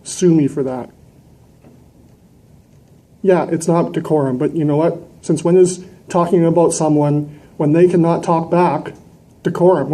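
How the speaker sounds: usual speaking voice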